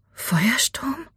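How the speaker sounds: high, hushed questioning call; hushed, high-pitched surprise; startled disbelief. A narrator's reading. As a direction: call the name softly in disbelief, rising. Startled sighting of horse in dark.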